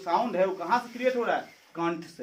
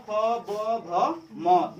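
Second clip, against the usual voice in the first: slow demo; 4 dB louder, much slower than usual. Shown as a demonstration. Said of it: "उसके बाद आपका आता है प".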